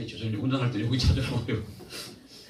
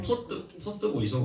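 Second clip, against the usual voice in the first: slow, higher Gyeongsang accent; Gyeongsang dialect; 6 semitones higher, 4 dB quieter, slower than usual. Shown as a demonstration. Preacher showing a Gyeongsang welcome, Gyeongsang accent. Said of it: "경상도는".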